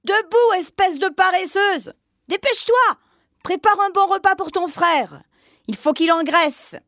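high, barking orders; harsh witch shout. Acted. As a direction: snap at the lazy girl. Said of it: "la réveilla brutalement et s'écria".

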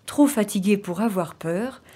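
plain reading voice

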